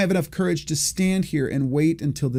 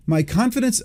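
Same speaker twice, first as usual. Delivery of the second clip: put-on a higher, half-started Austrian accent on "My confidence"; Arnold Schwarzenegger accent; 8 semitones higher than usual. Narrator starts quoting the bodybuilder in a mock Austrian accent. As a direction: slip into a mock Austrian bodybuilder accent, then catch yourself. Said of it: "I had to stop myself from doing it in an Arnold Schwarzenegger accent".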